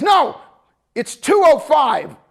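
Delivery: high, loud, slow shouted correction; drill sergeant shout. Retold or imitated. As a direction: shout the exact time at him.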